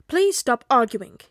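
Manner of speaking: loud, slow plea; pleading. A narrator's reading. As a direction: appeal firmly to squabbling classmates. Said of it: "Ida pleaded".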